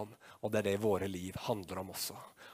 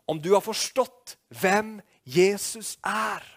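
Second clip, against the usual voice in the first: ordinary delivery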